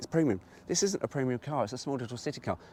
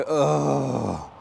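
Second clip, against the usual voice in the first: mock disgusted groan; 2 semitones higher, 4 dB louder than usual. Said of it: "You go".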